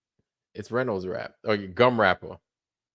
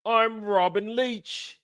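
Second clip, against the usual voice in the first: high, slow British TV host; Robin Leach; 9 semitones higher, slower than usual. Acted. Host introduces himself to viewers, high drawn-out showy voice. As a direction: draw out a high-pitched presenter introduction. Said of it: "Remember him?".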